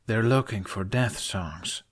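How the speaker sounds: ordinary delivery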